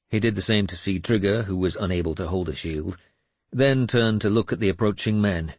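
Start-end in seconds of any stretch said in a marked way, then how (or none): none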